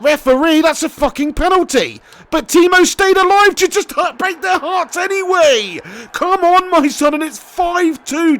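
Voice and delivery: celebrating the fifth goal at full voice; excited shout. Real, not acted. Penalty and goal in game.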